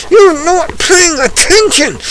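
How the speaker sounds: getting mad. Real, not acted. Angry ranter scolding viewers for ignoring him, sharp high outburst. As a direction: snap at the audience for not listening. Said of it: "And I'm getting mad".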